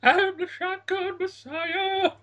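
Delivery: high, slow, grand proclamation; dramatic villain voice. Pretend. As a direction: announce yourself like an epic villain. Grand claim as [name].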